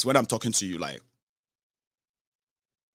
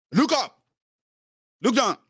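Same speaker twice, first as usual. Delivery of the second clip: high, sharp commands; acted parade-ground command voice; drill commander; 12 semitones higher than usual. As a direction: order them about like recruits. Acting a master giving drill orders.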